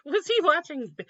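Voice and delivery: usual speaking voice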